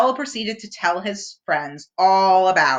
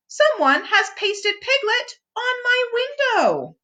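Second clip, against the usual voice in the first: Owl voice. A narrator's reading. Owl voiced in read story.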